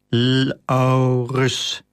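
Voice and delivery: drawn-out, spelling out Laurus letter by letter; slowly read out. A narrator's reading. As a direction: slowly piece together the name Laurus. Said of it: "las hij". (langzaam ontcijferd Laurus)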